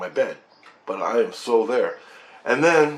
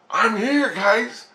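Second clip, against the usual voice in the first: pitched way up, elated; acted excited announcement; 12 semitones higher, much slower than usual. Dreamer announces his arrival to family, high and excited. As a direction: call it out high, reliving the thrill.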